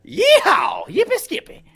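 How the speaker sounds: very high cowboy whoop; hammed-up hillbilly cheer; Cletus the robot. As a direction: squeal it like a cartoon cowboy. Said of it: "Cletus the robot".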